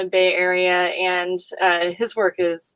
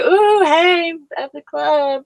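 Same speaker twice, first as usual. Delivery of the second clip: higher, playful mock cheer at the club; mock excited squeal; 9 semitones higher, 3 dB louder, slower than usual. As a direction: tease it like a giddy clubgoer. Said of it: "we're just like".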